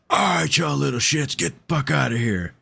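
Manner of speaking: a touch of an angry homeowner; telling kids on the porch to get lost; mock threat.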